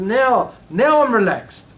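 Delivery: high, loud, drawn-out relief; retold relief. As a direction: voice the woman's relief, stretching it out. Retold woman relieved at seeing him.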